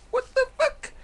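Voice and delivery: squeaky, very high mock disbelief; comic falsetto what-the-fuck; disbelieving outburst. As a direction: shriek it in sheer disbelief. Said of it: "I'm like".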